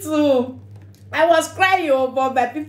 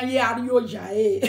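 ordinary talk

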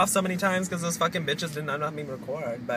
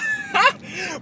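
high laugh. Real, not acted. Laughing after excited squeal.

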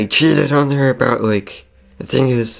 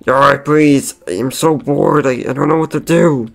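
higher, louder bored whine; acted plea of desperate boredom; whiny pleading; 4 semitones higher, 5 dB louder than usual. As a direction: pester him for something to do. Bored pirate fox begs.

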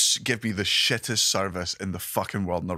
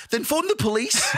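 pitched way up and exasperated; mock exasperation. Retold comeback to a shop clerk, high and exasperated. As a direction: throw it back at the clerk in disbelief. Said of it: "I'm like".

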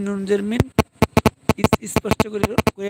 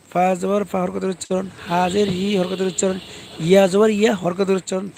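usual speaking voice

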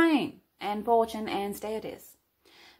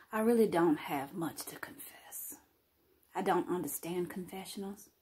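whisper; 4 semitones lower, 7 dB quieter than usual. Acted. Whispered confessional voice.

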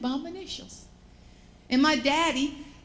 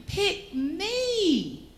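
mock scream; 8 semitones higher than usual. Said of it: "just screaming".

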